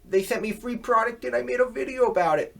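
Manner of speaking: mocking whiny excuse. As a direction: play the YouTuber dodging blame, all innocence. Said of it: "they always skirt around it".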